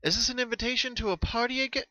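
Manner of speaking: a playful guess about a party; Twilight voice. Acted. Twilight greeting Pinkie at the door, high playful question.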